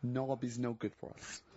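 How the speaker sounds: put-on hammy Russian accent sliding into German; deliberate, halting mock Russian; Tattoo's bad Russian accent. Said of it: "you don't sound so much Russian as German".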